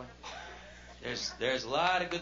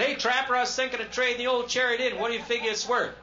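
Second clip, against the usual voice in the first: cheery buddy greeting; 9 semitones higher, 6 dB louder than usual. Retold or imitated. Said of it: "I said".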